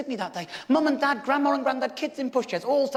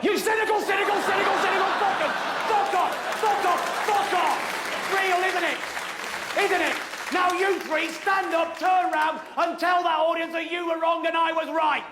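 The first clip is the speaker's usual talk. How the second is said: high, sustained angry yelling; full-on indignant rant, pitched high; furious outburst; 4 semitones higher than usual. Acted. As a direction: explode at the doubters. Furious outburst at doubting audience members.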